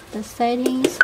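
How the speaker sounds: ordinary delivery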